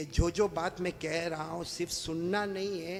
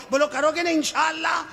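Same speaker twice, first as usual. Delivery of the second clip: crowd call; 8 semitones higher, 8 dB louder than usual. Real, not acted. Calling crowd for response.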